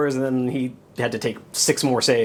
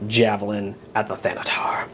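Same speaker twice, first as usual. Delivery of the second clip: whisper; 5 semitones lower than usual. Real, not acted. Whispered target choice.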